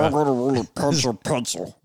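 squeaky gremlin voice; creepy little gremlin growl; demonic gremlin voice. Pretend. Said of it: "that sounds demonic".

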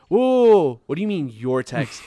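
big high loud whoa, then puzzled question; shocked whoa. Retold or imitated. Telling his friend how the girl's text news hit him, loud and shocked.

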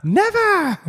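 viel höher, gequietschtes Never; hoch, übertrieben aus dem Film zitiert; movie villain. Retold or imitated. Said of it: "I think it's Home Alone".

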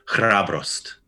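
Hrabrost with rolled r's, repeated. Shown as a demonstration. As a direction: roll the r's in Hrabrost again. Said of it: "two rolling R's".

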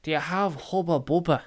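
a hint of a cheeky kid; lightly voiced kid. Kid rocks up asking the shop worker for gum, lightly voiced kid. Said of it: "this kid rocks up, he's like".

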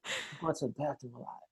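very soft, secretive whisper to the doctor; confiding whisper. Retold or imitated. Patient privately telling the chiropractor about bathroom progress, whispered. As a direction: imitate a patient whispering something private in your ear. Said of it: "they always whisper in my ear".

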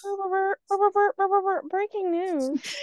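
high buzzy breaking news jingle; parody news anchor. Retold or imitated. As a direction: sing the jingle before the news. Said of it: "news anchor voice was pretty good".

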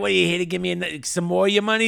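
loud, high-pitched jeering question; retold taunt. Gangster taunting the man about money, loud jeer. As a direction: imitate a cocky guy goading someone.

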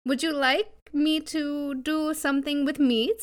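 ordinary talk

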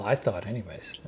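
soft whispered afterthought; whispered modest aside after a boast. Real, not acted. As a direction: slip it in under your breath.

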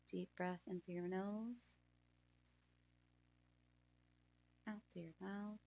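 breathy, hushed calming guide; guided meditation. Acted.

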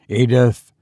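usual speaking voice

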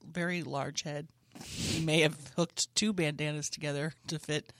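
low, halting official statement; police press conference. Acted. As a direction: read out a mock suspect description deadpan.

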